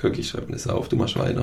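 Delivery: whispered aside; whispering. Real, not acted. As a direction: whisper it off-mic. Aside while writing.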